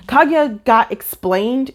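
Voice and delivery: ordinary delivery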